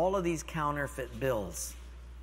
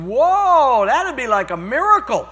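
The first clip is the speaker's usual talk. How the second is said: slow, loud, playful amazement; mock awe; 9 semitones higher, 12 dB louder than usual. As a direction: act hugely impressed for a child.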